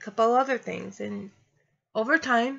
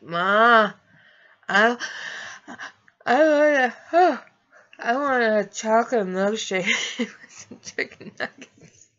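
louder and higher, slurring and giggly; loopy drugged pleading; 4 semitones higher, 4 dB louder than usual. Retold or imitated. Asking mom for a milkshake while medicated, loopy and giggly. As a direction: whine for a milkshake like a dazed kid.

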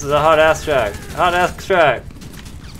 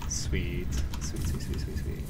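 whispered, unvoiced; whispering; 4 semitones lower, 7 dB quieter, slower than usual. Real, not acted. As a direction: breathe the words softly. Whispered over the money.

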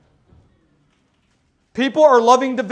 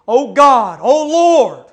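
louder, pleading cry; earnest prayer cry, louder; 7 dB louder, much slower than usual. Retold or imitated. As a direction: call out to God in prayer. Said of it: "When you hear in the prayers".

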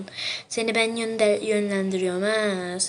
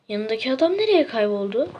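ordinary delivery

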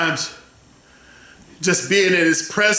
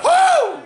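ecstatic whoop; 4 dB louder than usual. Real, not acted.

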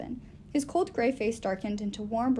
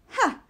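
crazy laugh; 11 semitones higher, 6 dB louder than usual. A narrator's reading.

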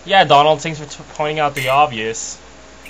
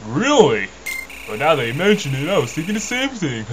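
put-on cartoon mouse voice, pitch swooping; Mickey Mouse. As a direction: attempt a cartoon mouse and miss slightly. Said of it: "more of a Mickey Mouse deep voice".

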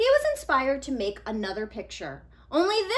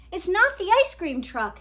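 put-on higher, louder eager boy; Nico explaining; 8 semitones higher, 9 dB louder than usual. Nico explaining his drawing to friends, eager and louder. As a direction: insist patiently.

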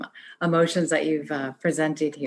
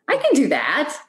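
bright high upbeat delivery, higher and louder; optimistic; 9 semitones higher, 5 dB louder than usual. Shown as a demonstration. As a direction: say "I can do that" with rising optimism. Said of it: "I was going for being optimistic".

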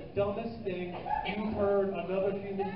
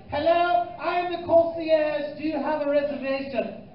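higher, slow, pompous concierge; 7 semitones higher, 4 dB louder, slower than usual. Retold or imitated. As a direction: play the stiff hotel concierge, drawn out. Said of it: "He said".

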